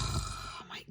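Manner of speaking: sigh, then whisper in awe. Real, not acted. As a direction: breathe it out, slow and hushed. Gushing over last four shades.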